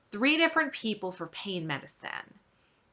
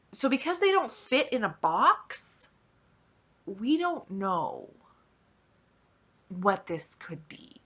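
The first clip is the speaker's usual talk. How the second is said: mimicking condescending doctor; 4 dB quieter than usual. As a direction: spell it out as if to a child. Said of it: "this is how he's talking to me".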